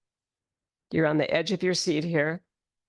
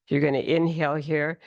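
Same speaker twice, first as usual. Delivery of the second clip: ordinary delivery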